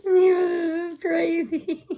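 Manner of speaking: slow, high, laugh-broken words; gasping it out through giggles. Real, not acted. Delighted by the mummy gnome, laughing hard.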